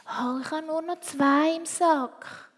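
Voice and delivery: put-on a reluctant little girl; cousin voice.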